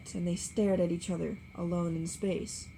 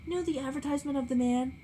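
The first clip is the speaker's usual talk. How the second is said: higher, light and dreamy; innocent; 7 semitones higher, faster than usual. A narrator's reading. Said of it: "she went on innocently".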